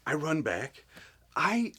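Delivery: ordinary talk, nothing marked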